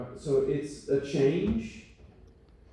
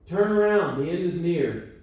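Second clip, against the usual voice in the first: louder Cajun accent, leaning on "Turn around"; 2 semitones higher, 6 dB louder than usual. Shown as a demonstration. Cajun pastors calling the sign to passing cars in Cajun accent. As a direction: call it out like a South Louisiana Cajun pastor. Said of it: "They do it in a Cajun accent".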